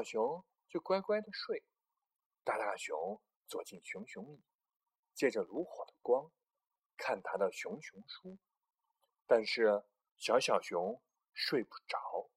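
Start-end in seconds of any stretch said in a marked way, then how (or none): none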